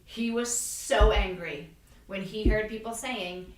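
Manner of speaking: normal talking voice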